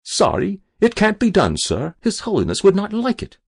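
high-pitched, polite refusal; firm but deferential keeper. A narrator's reading. Keeper telling the angler he must continue, firm refusal. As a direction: refuse respectfully in a high servant's voice.

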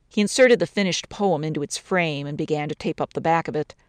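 ordinary delivery, nothing marked

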